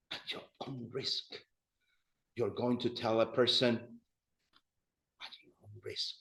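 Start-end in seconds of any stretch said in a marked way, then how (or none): 0.1-1.2 softer, low aside; hushed warning. Shown as a demonstration. 5.2-6.1 very soft, low whisper; whispered warning. Shown as a demonstration. Repeats the warning under breath.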